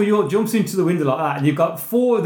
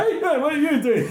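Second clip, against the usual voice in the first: mock shout, laughing; 8 semitones higher than usual. Acting out the bellows scene.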